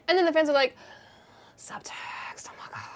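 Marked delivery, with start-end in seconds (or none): 1.6-2.8 hushed, voiceless gasp of words; awed fan whisper. Acted. Fans spotting subtext between the heroes, awed whisper. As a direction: whisper it as if overcome. Fans' reaction acted.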